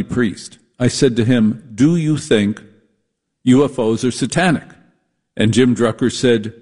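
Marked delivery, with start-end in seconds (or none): none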